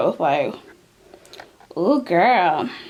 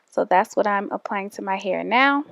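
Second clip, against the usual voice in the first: ordinary delivery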